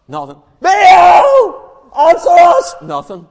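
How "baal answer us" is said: high, forceful yell; louder shouting. Acted. Prophets getting no reply from Baal, shouting harder. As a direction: yell hard for an answer. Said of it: "started getting a wee bit louder".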